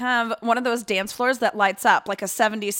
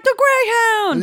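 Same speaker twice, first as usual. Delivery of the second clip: calling out; 13 semitones higher, 4 dB louder, slower than usual. Acted. Calling out own stage name.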